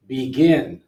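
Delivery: beGIN, loud second syllable; second-syllable stress. Shown as a demonstration. Teacher sorts words by stress, second syllable of Begin punched. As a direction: hit the last syllable of Begin. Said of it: "second syllable".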